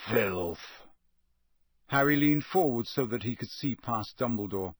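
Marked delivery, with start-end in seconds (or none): 0.1-0.9 low growl of disgust. A narrator's reading. Moody reacting to Karkaroff's plea, growling. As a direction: growl it low under your breath. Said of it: "a familiar growling voice saying".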